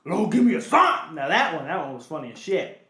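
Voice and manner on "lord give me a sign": loud, high, rough rapper shout, then slower. Retold or imitated. Quoting [name] song lyrics.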